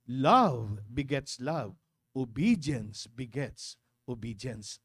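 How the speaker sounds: slow and low, words stretched out; slow low maxim. Real, not acted. Repeats maxim slowly, lower. (mabagal at mababang pagbigkas ng kasabihan)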